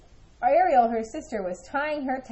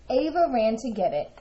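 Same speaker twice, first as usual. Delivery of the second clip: ordinary delivery, nothing marked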